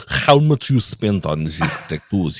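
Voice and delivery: put-on Russian accent. Streamer offering Russian instead of Australian, mock Russian accent. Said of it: "I can do Russian".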